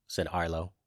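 usual speaking voice

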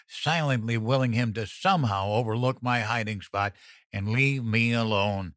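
plain reading voice

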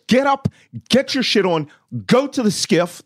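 high, insistent commands; urgent orders. Retold or imitated. Retold urgent orders by phone.

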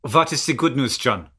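put-on German accent. Cohost prompts John for the good news, German accent. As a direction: ask it in a mock German accent. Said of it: "I'll never do German accent again".

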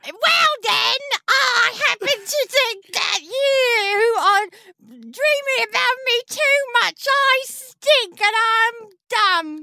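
high, slow, drawn-out fake accent; hammed-up high impostor voice with stretched words; Janice. Chalice answers about the missing accent, high mock-foreign Janice voice. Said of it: "Where's your extremely specific foreign but not quite racist accent?".

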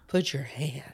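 hushed mimicry of a sleep-talker; whispered imitation. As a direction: whisper it very softly, as if asleep. Replaying the sleep-talk line in imitation.

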